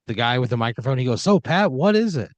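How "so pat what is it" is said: high, loud showman host voice; bright TV emcee putting the contestant on the spot; cheesy game show host calling on the player. Acted.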